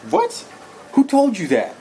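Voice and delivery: squeaky high shocked "What?"; surprised. Retold or imitated. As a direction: disbelief jumping way up in pitch. Stunned reply to hotel clerk.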